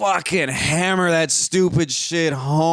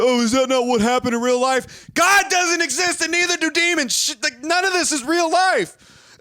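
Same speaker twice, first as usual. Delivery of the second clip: mocking; 13 semitones higher than usual. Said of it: "I was like".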